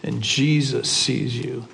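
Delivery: a creepy disembodied voice in the dark; parrot voice. Acted. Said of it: "And the parrot says".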